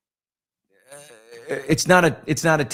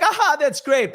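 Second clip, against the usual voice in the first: mock gushing star; 14 semitones higher, 4 dB louder than usual. Stars mocking the speech time limit.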